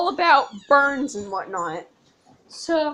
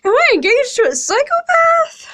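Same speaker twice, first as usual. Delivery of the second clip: higher and louder, tearful and upset; crying while talking; 5 semitones higher, 6 dB louder than usual. Real, not acted. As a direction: talk through tears, half laughing. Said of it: "and I'm crying".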